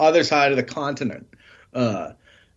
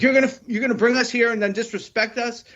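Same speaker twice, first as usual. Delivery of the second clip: higher, indignant mock outrage; angry retort; 9 semitones higher than usual. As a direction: imitate a wrestler getting offended. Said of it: "Grayson Waller gets pissed".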